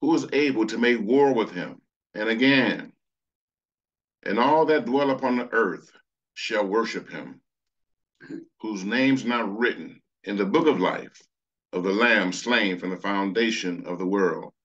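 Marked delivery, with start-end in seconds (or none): none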